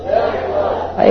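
slow, soft, drawn-out South Georgia speech; South Georgia drawl. Shown as a demonstration. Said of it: "they talk like that".

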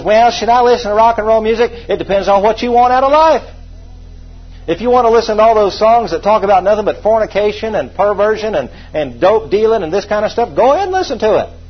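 emphatic sermon rebuke; full-force preaching. Real, not acted.

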